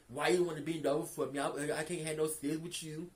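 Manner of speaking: a hint of a dopey man, mocking him; mocking his voice. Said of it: "I'm gonna stop talking like that".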